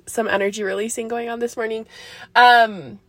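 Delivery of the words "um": normal talking voice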